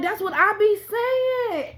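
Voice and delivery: high-pitched, drawn-out excited agreement. Retold or imitated. Retelling own excited agreement in dream.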